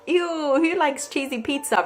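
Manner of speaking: high, mock-disgusted ew; playful fake disgust at cheesy pizza; teasing banter. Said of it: "he knows it's banter".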